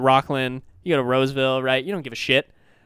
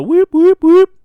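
loud, high siren whoops; imitated alarm whoops; 14 semitones higher, 14 dB louder, much slower than usual. Fingerprint check raising red flags, loud mock alarm.

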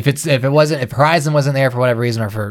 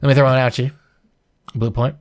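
talking while chewing gum; words round a wad of gum; 2 semitones higher than usual. Real, not acted. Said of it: "I'm chewing gum during this thing".

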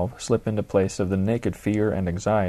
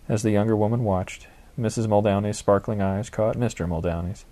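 plain reading voice

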